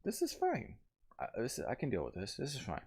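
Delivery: self-reassuring voice. Retold or imitated. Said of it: "be like".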